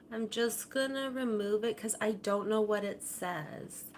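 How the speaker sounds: normal talking voice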